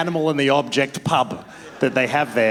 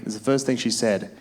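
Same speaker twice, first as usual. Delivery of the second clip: normal talking voice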